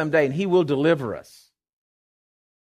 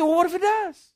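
high-pitched challenging comeback; emphatic retort; 13 semitones higher than usual. Retold or imitated. Preacher answering people who say don't give faith, emphatic retort. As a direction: throw it back at the doubters, high.

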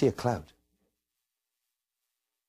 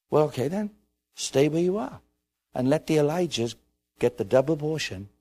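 ordinary talk, nothing marked

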